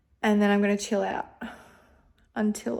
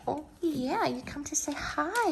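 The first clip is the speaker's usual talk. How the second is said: soft, higher coo to the dog; sweet talk to a visiting pup; cooing pet voice; 6 semitones higher, 4 dB quieter than usual. Real, not acted. Coos softly to the puppy who came to say hi.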